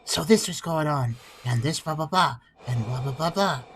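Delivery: very low, hushed voice-actor imitation; serious low murmur. Imitating the game's understated outro voice actor, low serious murmur. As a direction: drop way down, soft, understated and serious. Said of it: "talking in this kind of time, tone of voice".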